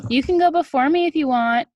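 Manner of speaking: put-on high, loud eager kid voice; stalling kid. A narrator's reading. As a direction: offer your turn brightly to stall. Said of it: "he told the kid behind him".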